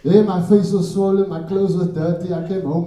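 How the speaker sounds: ordinary talk, nothing marked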